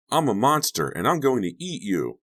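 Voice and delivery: high, playful monster threat; child playing monster. Masked Donnie threatens Chuck, high mock-monster child voice. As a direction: menace playfully as a small boy. Boy plays monster in mask.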